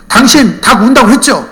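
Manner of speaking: high, loud acted accusation; angry confronting. Cheated buyer confronts the chicken seller, accusing. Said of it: "막 따졌어요".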